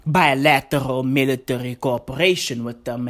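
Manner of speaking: low-pitched, hesitant bad Russian accent; horrible Russian accent. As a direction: fake a clumsy Russian accent, hemming and hawing. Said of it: "Horrible Russian accent".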